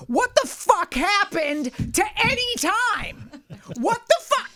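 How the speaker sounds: furious yell. Retold or imitated. Yelling at a friend over broken promises, furious. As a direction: explode at him in the car. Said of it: "I'm like".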